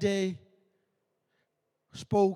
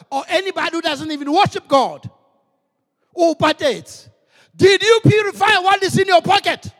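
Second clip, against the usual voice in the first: full-force preaching; 5 semitones higher, 8 dB louder than usual. Acted. Preacher urging the congregation to give first to the Lord, fervent. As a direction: drive each line home like a revival preacher.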